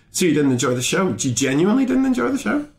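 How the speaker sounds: high-pitched disbelieving question to a heckler; can't-believe-it retort, pitch shooting up at the end; incredulous questioning. Retold or imitated. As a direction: climb into rising, astonished disbelief.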